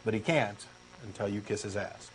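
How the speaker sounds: usual speaking voice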